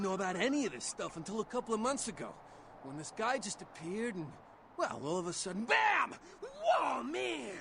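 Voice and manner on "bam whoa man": loud, high burst of amazement; excited shout. Retold or imitated. Ghoul reliving his discovery to a vampire, loud amazed shout. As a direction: blurt it out in wonder.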